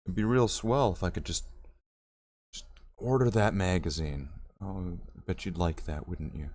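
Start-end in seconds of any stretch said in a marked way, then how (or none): 4.6-6.5 taunting. Retold or imitated.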